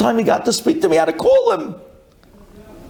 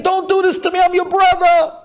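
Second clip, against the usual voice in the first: screaming, begging; 12 semitones higher than usual. Retold or imitated. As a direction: beg for your life at full voice. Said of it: "he was screaming, begging".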